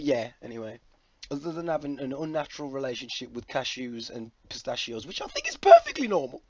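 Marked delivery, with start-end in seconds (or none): 5.1-6.4 very high, loud comic squeak; mock-defensive squeal. Vlogger jokily defending his love of cashews, mock-defensive squeal. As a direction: protest it in a loud falsetto.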